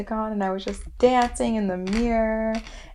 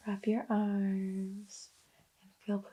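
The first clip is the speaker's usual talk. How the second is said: slow, hushed imitation of a meditation video; soft, drawn-out soothing instructions; sweet soothing guide; 9 dB quieter, slower than usual. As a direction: gently guide them, almost whispering. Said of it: "it was so sweet".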